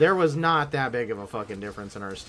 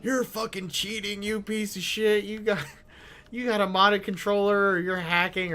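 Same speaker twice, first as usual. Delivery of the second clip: higher-pitched scornful accusation; mock hate mail; 6 semitones higher than usual. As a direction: imitate an opponent accusing him of cheating. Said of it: "somebody's like".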